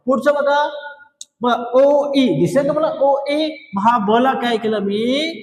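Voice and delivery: normal talking voice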